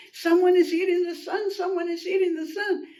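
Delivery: high, frightened animals huddled together; so scared. Acted. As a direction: wail it in storybook fear. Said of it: "so scared".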